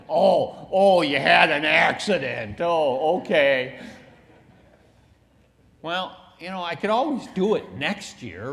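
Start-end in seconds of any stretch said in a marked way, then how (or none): 0.1-3.8 loud, exaggerated fake sympathy; hammed-up pity; mock sympathy. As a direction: coo it like a patronising onlooker. Mocking sympathetic onlookers.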